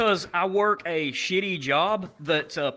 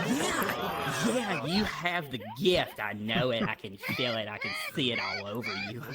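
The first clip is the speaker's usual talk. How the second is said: lightly voiced flamboyant tour guide; 4 semitones lower, 5 dB quieter than usual. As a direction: lightly mimic a tour guide sure of his hunch.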